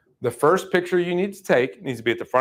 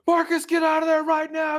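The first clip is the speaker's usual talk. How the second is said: urgent shout. Retold or imitated. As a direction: shout a warning across the yard, way up high. Homeowner warns him off the dog.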